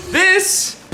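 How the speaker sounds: high, bright reveal; excited reveal. Real, not acted. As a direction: present it with a flourish. Showing off package.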